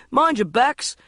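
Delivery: loud crew shout. A narrator's reading. Crewman warning everyone while carrying a light, loud shout. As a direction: call out a warning.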